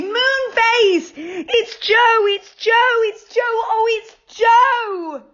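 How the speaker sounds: high calling shout; calling through the door joyfully; yelling out. A narrator's reading. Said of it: "yelled out loudly".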